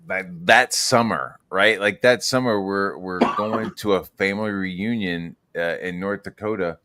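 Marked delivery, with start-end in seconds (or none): none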